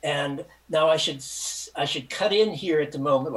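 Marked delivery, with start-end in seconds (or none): none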